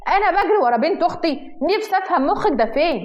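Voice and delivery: high-pitched, fuming scolding; exasperated shouting. A narrator's reading. Yazan scolds wife over chasing his niece, exasperated. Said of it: "وبيزعق بغيظ".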